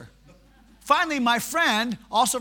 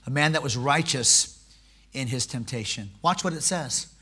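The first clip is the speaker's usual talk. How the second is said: much lower, softer reflective sermon; quiet preaching; 12 semitones lower, 9 dB quieter than usual. Acted. Preacher praising courageous believers, low and soft. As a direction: speak it low and reverent.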